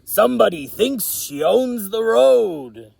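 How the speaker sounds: high, loud, croaky complaint; grumpy croaking toad. Acted. Toad grumbles about the race car, croaky and loud. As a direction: grumble like an offended old toad. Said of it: "croaked Toad".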